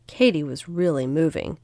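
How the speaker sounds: plain reading voice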